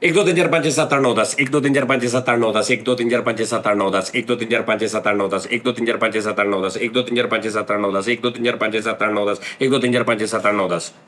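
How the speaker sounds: counting against the clock in a speed-talk contest, words blurred together. Shown as a demonstration. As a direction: race through one to ten again and again for the stopwatch. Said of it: "ਇੱਕ minute 'ਚ ਮੈਂ ਕਿੰਨੀ ਵਾਰੀ ਕਹਿਣਾ".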